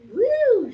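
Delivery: high, loud sigh of relief; a child's loud whew after counting her money; relieved whew. A narrator's reading. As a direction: blow it out in big relief. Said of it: "she said".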